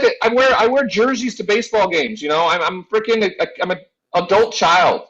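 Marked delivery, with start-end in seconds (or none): none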